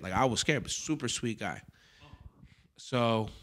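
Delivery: ordinary talk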